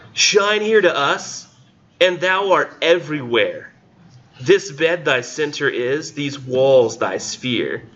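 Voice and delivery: high, slow, theatrical poetry recital; lofty slow recital, pitched high; weird dramatic reading voice. Acted. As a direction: declaim it like an old stage actor. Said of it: "read this with this weird voice".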